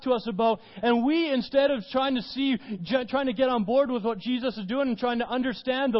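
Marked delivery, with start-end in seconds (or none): none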